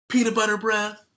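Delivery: high, loud schoolyard taunt; taunting insult. Acted. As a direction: jeer it across the lunchroom. Kids trading insults.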